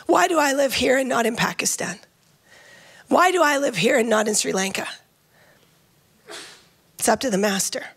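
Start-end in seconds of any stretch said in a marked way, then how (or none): none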